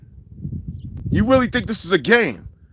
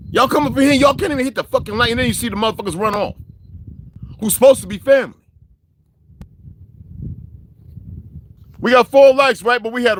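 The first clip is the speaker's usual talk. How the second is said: angry rant; 5 semitones higher than usual. Real, not acted. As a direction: vent at the viewers for not showing up. Ranting at viewers about likes.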